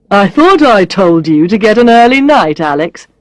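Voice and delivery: lightly voiced chiding boss; a hint of stern reproach for an employee; reproachful boss. A narrator's reading. Boss voices mild reproach to Alex.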